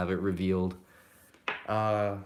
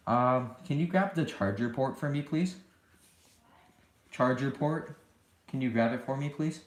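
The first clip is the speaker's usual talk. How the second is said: higher voice, calling across the house; 5 semitones higher than usual. Real, not acted. Asking mom for the charger.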